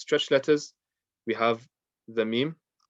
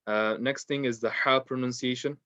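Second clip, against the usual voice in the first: ordinary delivery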